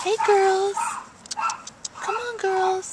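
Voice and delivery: high, loud coaxing call to the hens. Real, not acted. Calling chickens out of chimenea.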